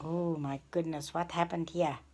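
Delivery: ordinary delivery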